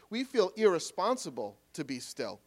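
normal talking voice

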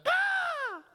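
high, drawn-out crow call; very high mock bird cry; imitated crow caw. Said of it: "one of 'em will be like".

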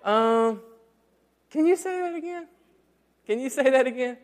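hushed caught-out husband asking again; sheepish ask. Retold or imitated. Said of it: "To which I have to say".

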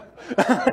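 a short, high, real laugh; amused laugh. Real, not acted. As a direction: laugh at the irony of it. Laughs at his own point.

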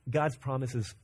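normal talking voice

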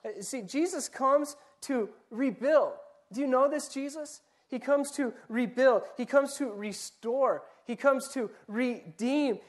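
full-force preaching. Acted. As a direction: drive each line home to the congregation.

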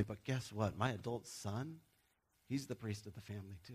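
usual speaking voice

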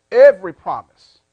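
EVERY hit very loud, then dropping; emphatic repeat. Real, not acted. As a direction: punch out Every as the key word. Preacher repeats key words for emphasis.

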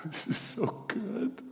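high, soft, slow words through laughter; real laughing. Real, not acted. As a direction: let laughter swallow the words. Preacher overcome with laughter.

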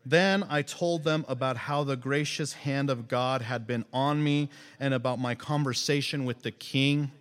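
ordinary delivery

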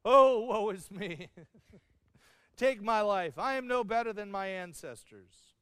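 mock tragic moaning, half laughing; melodramatic lament. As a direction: overplay the tragic prophet. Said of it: "Very dramatic".

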